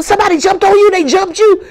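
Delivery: high, loud alarmed yell; a brother shouting in shock at her face. Retold or imitated. Brother asks if she was jumped.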